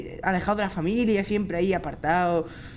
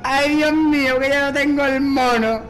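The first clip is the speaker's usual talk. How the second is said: high, drawn wail; loud lament; 6 semitones higher, 5 dB louder than usual. Real, not acted. Player robbed of the monkey, wailing lament. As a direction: wail it in dismay.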